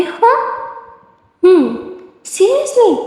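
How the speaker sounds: high, incredulous hah; indignant disbelief. Acted. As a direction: jump way up in pitch, offended. Indignant at a cold reply. (चौंककर ऊँची आवाज़ में "seriously?")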